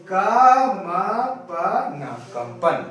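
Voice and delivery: "का", "मा", "पा ना" with pauses between, then "कंपन" said whole; syllable by syllable. Shown as a demonstration. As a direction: break "कंपन" into syllables, then join them. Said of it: "इसलिए हम यहाँ पे पढ़ते हैं".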